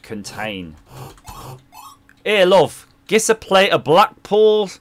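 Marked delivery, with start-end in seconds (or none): 2.2-4.7 loud, hammed-up Blackpool vendor; broad Lancashire accent. Vendor offers viewers Blackpool sweetmeats in a loud Lancashire accent. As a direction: bellow it like a seaside stall holder, northern accent. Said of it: "I'm dead good on accents".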